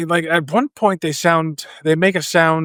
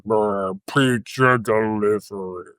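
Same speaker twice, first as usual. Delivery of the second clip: alien voice; 6 semitones lower, 4 dB quieter, slower than usual. Retold or imitated. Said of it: "the one guy goes".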